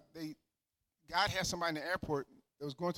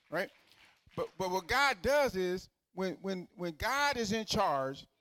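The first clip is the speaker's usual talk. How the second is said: higher and louder, driving the point home; full-force preaching; 3 semitones higher, 5 dB louder than usual. Acted. Preacher rouses his congregation with ringing full-force preaching. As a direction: preach it, rising to a climax.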